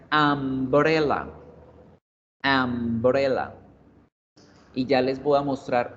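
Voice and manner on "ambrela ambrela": umbrella opened with an A sound, drawn out; demonstrated pronunciation. Shown as a demonstration. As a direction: say umbrella slowly as "ambrella". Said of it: "se pronuncia umbrella".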